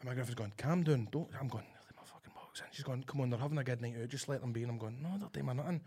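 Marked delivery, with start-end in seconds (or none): 0.6-1.3 softly voiced girlfriend calming him; hushing. 1.6-2.6 irritated cinema whisper; whispered complaint. Retold or imitated. 3.0-4.6 put-on soft, low girlfriend hushing him; calm your partner in an undertone; quiet pleading. 5.0-5.8 soft insistent undertone; muted protest. Retold or imitated. Quiet argument retold from cinema.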